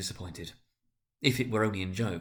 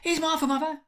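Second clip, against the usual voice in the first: put-on much higher, childish cry; little kids shouting with glee; excited children; 4 dB louder than usual. A narrator's reading. Young children crying out to mother, high childish voice. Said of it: "cried the two young Cratchits".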